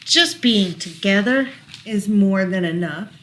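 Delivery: lilting rhyming read-aloud; reading to children. Acted. Parent reading a rhyming book to child, gentle lilt. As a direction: read the rhyme gently to a toddler.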